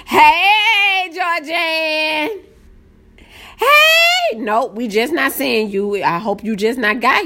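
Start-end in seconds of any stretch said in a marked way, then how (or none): 0.1-4.4 high hello climbing to a squeal; excited high-pitched greeting. Real, not acted. Streamer greeting a viewer, excited high call. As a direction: call the name out high, overexcited. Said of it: "my voice get high-pitched".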